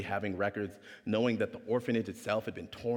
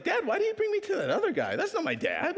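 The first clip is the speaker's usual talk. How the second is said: very high, acted kid voice; puzzled little-kid protest; child questioning dad. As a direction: pipe up like a confused child. Boy questioning his dad.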